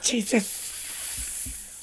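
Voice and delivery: high unvoiced snake hiss; whisper yelling. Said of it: "What other pop culture snake".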